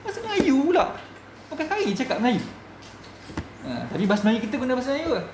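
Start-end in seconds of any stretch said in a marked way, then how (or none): 0.0-2.4 high, mocking voice of a scoffer; dismissive scoff. Preacher mocking someone who dismisses learning language, high and scornful. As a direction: brush off language study with a sneer. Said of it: "ingat kata".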